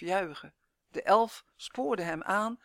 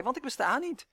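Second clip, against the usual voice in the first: higher, taunting lilt; gloating grin; 6 semitones higher than usual. A narrator's reading. Elf turning the boy's words on him, grinning. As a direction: throw it back teasingly, grinning. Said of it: "grijnsde hij".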